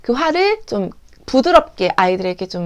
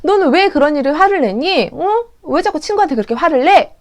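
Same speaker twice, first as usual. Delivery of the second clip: higher-pitched, mildly cross teacher; mock angry scolding; 5 semitones higher than usual. Teacher scolding a child for getting angry, mock cross. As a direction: demand an explanation in acted exasperation. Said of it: "선생님이 오히려 화를 내버리시는".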